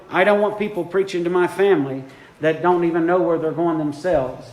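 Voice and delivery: ordinary delivery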